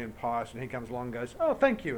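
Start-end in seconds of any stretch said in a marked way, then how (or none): 1.4-2.0 high, loud cheeky thanks; snatch it with a bright cheeky thank you; mock grateful prankster. Prankster grabs offered food bowl.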